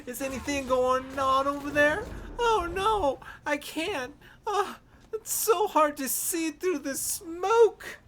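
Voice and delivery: enemy mocked, high and whining with a fake cry; confused enemy player. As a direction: whine like a lost, helpless player in the smoke. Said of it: "So they're like".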